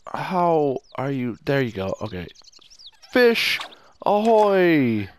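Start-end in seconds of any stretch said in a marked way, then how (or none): 3.1-5.1 playful greeting. Pretend. Greeting a fish in game.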